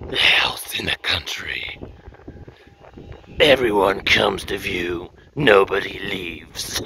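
low, very slow, whispery menace; hammed-up horror film voiceover, drawn out; horror trailer voice. Pitching a horror film, creepy trailer voice.

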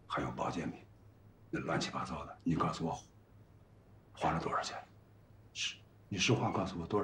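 low, soft, even-tempered questioning; calm coaxing. Acted. Husband asks his wife what she spent, calm and even-tempered. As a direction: keep it calm and low, gently coaxing an answer. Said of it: "咱们心平气和的说啊".

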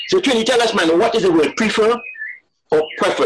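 high-pitched call for a ruling; arguer appealing to passer-by. Acted. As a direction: hail a passing Trinidadian to settle the argument. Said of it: "at Trinidadian passing".

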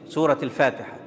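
ordinary talk, nothing marked

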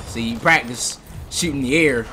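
ordinary talk, nothing marked

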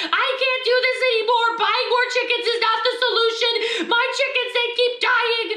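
high-pitched hammed-up wailing; mock crying. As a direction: wail it like a melodramatic sobbing farmer. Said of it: "she was crying to Garrett".

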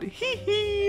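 tiny, very high soft tee-hee; cutesy fake giggle. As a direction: squeak out a coy put-on giggle. Reads out a written giggle.

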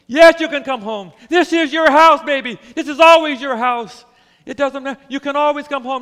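loud, high, impassioned welcome home; a father pouring out love at full voice; fervent father. Acted. Father embracing returning son.

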